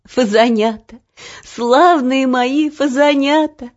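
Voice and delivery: overcome, with a touch of happy tears; tearful joy, sobbing. A narrator's reading. Auntie repeating endearments to the children, mildly sobbing with joy. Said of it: "без конца повторяла, всхлипывая".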